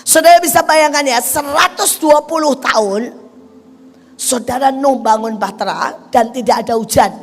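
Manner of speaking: loud, fervent sermon delivery; full-force preaching. Real, not acted. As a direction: preach it out with fire.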